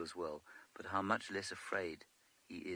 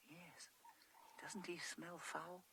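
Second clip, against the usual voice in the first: hushed whisper so the bull stays calm; 10 semitones higher, 10 dB quieter than usual. Real, not acted. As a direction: whisper close to the animals. Near elephants, keeping quiet.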